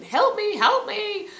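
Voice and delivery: imitated high-pitched frantic cries; baby goat screaming for help. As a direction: cry out as a terrified baby goat.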